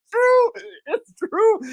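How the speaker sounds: giggling it's true; laughing. Real, not acted. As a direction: laugh through it. Laughing agreement.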